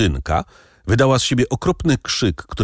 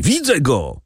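shout; 6 semitones higher than usual. A narrator's reading. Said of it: "krzyknął głos za drzwiami".

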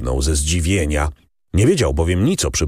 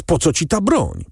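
exclaiming; 10 semitones higher than usual. A narrator's reading. Son asks his father about the weapon, exclaiming. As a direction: demand an answer, pitch raised. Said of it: "wykrzyknął młodzieniec".

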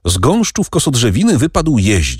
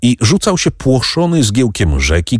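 plain reading voice

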